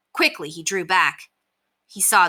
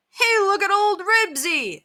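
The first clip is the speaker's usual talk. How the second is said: high, slow, drawn-out call; excited call; slower than usual. A narrator's reading. Scooter arrives and spots the fight, calling excitedly. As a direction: call out to everyone, pitched way up. Onlooker calls out at dog fight.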